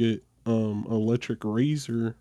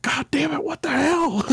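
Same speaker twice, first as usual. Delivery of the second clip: shrill mom voice. Retold or imitated. Mom angry about haircut.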